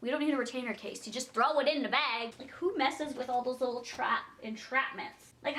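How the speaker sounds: ordinary talk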